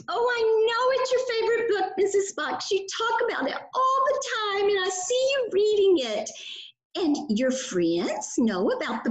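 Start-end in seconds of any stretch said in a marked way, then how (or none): 0.1-6.3 put-on high childlike puppet voice, quick and eager; quick, high kid puppet answering his teacher. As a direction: play the little puppet pupil, chirpy and fast. Puppet Burley talks to Mrs. Fox.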